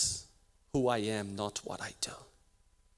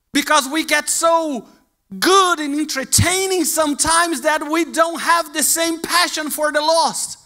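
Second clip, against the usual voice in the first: loud, high, driving sermon voice; preaching at full force; 10 semitones higher, 10 dB louder than usual. Acted. Preacher urging the church in a loud, high sermon voice. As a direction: pound the message home from the pulpit.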